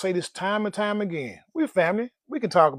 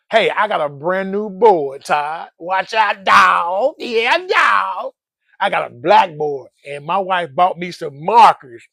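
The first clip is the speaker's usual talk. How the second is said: louder, thrilled about his new board; excited; 8 dB louder, slower than usual. Real, not acted. Telling viewers about his new blackboard, loud and excited.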